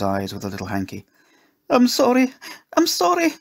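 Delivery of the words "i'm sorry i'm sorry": very high, loud, wailed apology; tearful wail. A narrator's reading. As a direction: wail it like a distraught little girl. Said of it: "she wailed".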